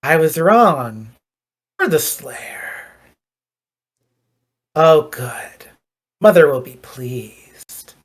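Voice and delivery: a touch of creepy vampire, slow with a whispered middle; lightly voiced creepy vampire luring the Slayer in. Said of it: "smiles really wide and really creepy and goes".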